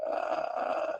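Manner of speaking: soft creaky morning fry noise; vocal fry. Shown as a demonstration. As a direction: croak softly like just waking up. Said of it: "this register is the vocal fry".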